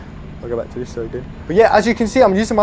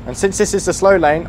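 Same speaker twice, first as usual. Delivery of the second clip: normal talking voice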